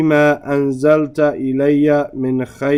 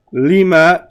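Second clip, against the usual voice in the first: ordinary talk, nothing marked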